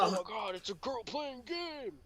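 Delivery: high, stretched-out fake amazement; mock-excited gamer dude. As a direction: imitate a starstruck gamer dude. Voicing dude excited by girl.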